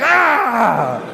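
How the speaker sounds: loud, high "aah" cry; imitated excited yell; mock scream. Imitated reaction after "they'll be like.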